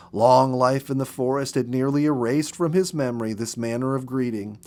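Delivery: ordinary delivery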